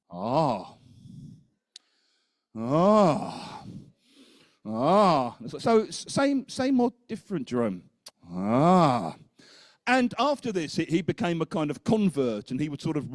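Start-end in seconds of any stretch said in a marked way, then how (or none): 0.1-5.4 dawning realisation. Retold or imitated. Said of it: "light bulb moments where he sort of went". 8.3-9.1 realisation. Retold or imitated. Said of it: "light bulb moments".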